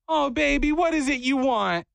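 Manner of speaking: high doting lady impression; Miss Glenda. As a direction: coo to a little customer in a high voice. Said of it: "And she goes".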